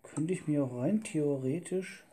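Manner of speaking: ordinary talk, nothing marked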